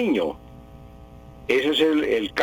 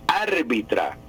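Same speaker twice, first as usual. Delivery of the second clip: usual speaking voice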